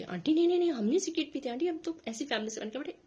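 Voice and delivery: put-on hurried protest of nervous kids; flustered students. Ninth graders denying to the aunt that they smoke, flustered. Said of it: "वो confuse हो गए".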